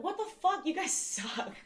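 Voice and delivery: high-pitched mock outrage that breaks into a laugh; a retold "what the fuck", pitched high, then giggle; outraged disbelief. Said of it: "And I was just like".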